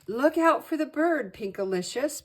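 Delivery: high, loud warning holler. A narrator's reading. Brother hollering a warning to his sister. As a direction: shout the warning across the sky. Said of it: "Peter hollered".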